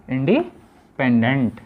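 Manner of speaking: slow independent, split midway; drawn-out term. Shown as a demonstration. Teacher summing up for pupils, slow and broken up. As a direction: stretch independent out in two pieces.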